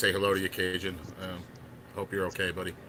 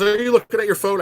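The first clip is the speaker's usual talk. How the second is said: high, loud eager question; excited friend; 11 semitones higher, 9 dB louder than usual. Retold or imitated. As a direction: press him to look, much higher and louder. Excited friend voiced on phone.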